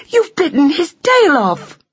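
very high distressed wail; aghast young mouse, shrieky pitch; horrified accusation. Acted. Flora accuses Buck, horrified.

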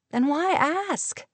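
high, slow prompting question. A narrator's reading. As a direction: draw out the question, pitched well up. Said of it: "Linda prompted".

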